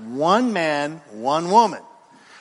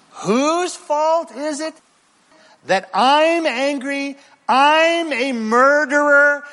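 mock self-pity; 8 semitones higher, 4 dB louder, slower than usual. Mocking Cain's self-pity.

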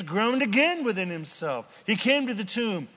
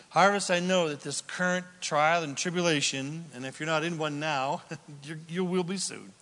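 normal talking voice

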